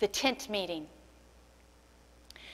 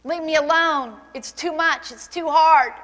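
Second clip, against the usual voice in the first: loud, higher, fast shout at God; angry shout at God; 5 semitones higher, 8 dB louder than usual. Retold or imitated. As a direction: hurl it at God in despair, loud and pitched up. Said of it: "And she said".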